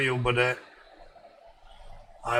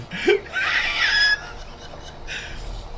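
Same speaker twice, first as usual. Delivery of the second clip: big laugh. Real, not acted. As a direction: crack up at your own joke, pitch flying up. Laughs at magic mushroom joke.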